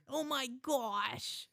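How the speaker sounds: slow, high American kid impression; American accent. Dad imitating his son's double accent, drawn-out American. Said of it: "Just like that".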